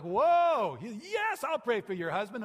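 high, loud, bursting "Whoa"; shy young man thrilled after the healing, loud and high; thrilled agreement. Retold or imitated. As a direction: beam with sudden confidence. Kent feeling good.

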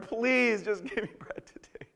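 drawn-out, high pitiful plea; mock begging. Preacher mimicking a beggar asking for bread, pleading. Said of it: "begging for bread".

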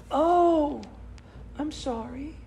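high, fading mock-sorry; mock apology. Pastor mimicking the offender, high and soft.

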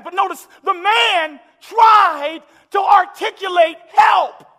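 loud, slowing, climbing preacher's cry; rising sermon climax, loud and drawn out; full-force preaching. Acted. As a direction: preach it to the rafters.

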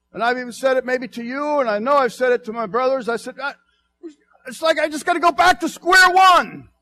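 loud, high, driving sermon voice; fiery preaching. Acted. Pastor confessing his struggles to the congregation, preaching loud. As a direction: preach it out full force to the church.

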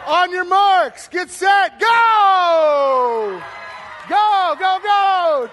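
shouting the start of a race to the crowd. Real, not acted. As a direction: fire them off the line with a big yell.

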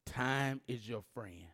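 slow, spaced out, time is your friend; slow and deliberate. Shown as a demonstration. Preacher addressing the middle section, slow and deliberate. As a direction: lay each word down slowly. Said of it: "I'm gonna say it in the middle".

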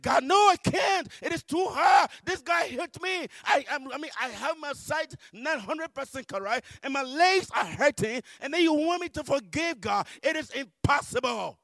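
high, shouted refusal to God; anguished protest. Retold or imitated. Believer telling God forgiveness is too hard, anguished protest. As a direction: plead at the top of your voice.

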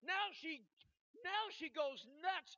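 loud, high-pitched preacher at full force; urgent pulpit shout; impassioned preaching. Real, not acted.

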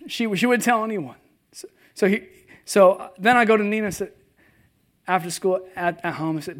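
ordinary talk, nothing marked

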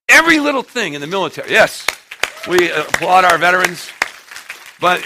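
high, loud, fast preacher climax; fiery sermon peak; loud preaching. Real, not acted. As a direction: drive it home to the crowd.

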